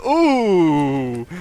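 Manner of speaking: drawn-out ghostly ooh. Pretend. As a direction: moan it like a ghost. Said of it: "I'm trying to sound like a ghost".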